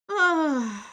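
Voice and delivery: loud, pained groan of frustration; frustrated groan. Real, not acted. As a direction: groan out in annoyance. Frustrated at broken alert.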